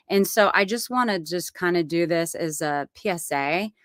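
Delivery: normal talking voice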